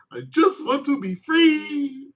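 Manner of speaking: high, slow sobbing plea; hammed-up sob, high and slow; mock crying. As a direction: ham up the tearful sob. Dramatic breakup climax.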